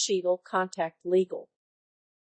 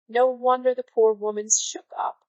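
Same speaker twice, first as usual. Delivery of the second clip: usual speaking voice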